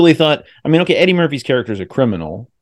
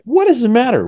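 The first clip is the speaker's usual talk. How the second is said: high, incredulous challenge; indignant retelling; 13 semitones higher than usual. Retold or imitated. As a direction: act out disbelief, pitch shooting up. Acts out indignant reaction to character.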